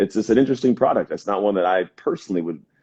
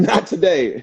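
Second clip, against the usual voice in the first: a higher, mock voice for a stubborn back; back talking; 8 semitones higher than usual. Back refusing to move, lightly voiced and mock-cheeky. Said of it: "my back says".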